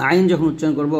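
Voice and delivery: usual speaking voice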